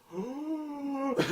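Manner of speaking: long high "ooh" of mock wonder; mock awed ooh. Reviewer imitating dwarves hearing about moon letters, mock awed ooh. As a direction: coo like clueless dwarves being amazed. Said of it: "the dwarves are like".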